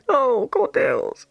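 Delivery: real teary laugh, voice breaking; a crying laugh that cracks the words; laughing through tears. Real, not acted. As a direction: laugh it out while choking up.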